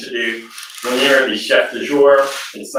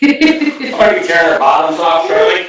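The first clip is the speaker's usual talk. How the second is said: walrus; 4 semitones higher, 5 dB louder than usual. Pretend. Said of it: "[name]'s gonna do his walrus impression".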